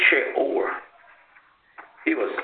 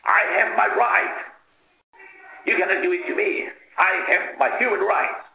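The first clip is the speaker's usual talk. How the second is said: high, loud desperate shouting; 8 semitones higher, 3 dB louder than usual. Retold or imitated. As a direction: yell it with all you have. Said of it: "he shouted at the top of his voice".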